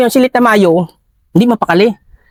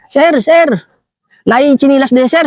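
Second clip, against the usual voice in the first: higher-pitched urgent call of sir, sir; retold excited call; 6 semitones higher than usual. Father calling troops about the slippers, in a high urgent call. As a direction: mimic a soldier calling out his find. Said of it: "Sigaw-sigaw siya".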